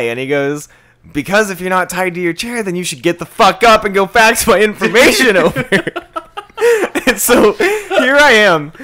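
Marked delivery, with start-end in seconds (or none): 1.1-5.6 high and loud, building to a yell; irate caller. Retold or imitated. Acted irate caller blowing up. 5.7-8.7 high, loud and slow through laughter; real laughter in the voice; laughing while talking. Real, not acted. Laughing after caller's outburst.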